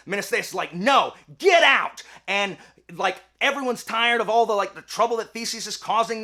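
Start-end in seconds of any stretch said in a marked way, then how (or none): 0.7-2.0 loud, drawn-out mock order; emphatic comic yell; angry refusal. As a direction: throw them out with a slow shout. New king refuses the throne.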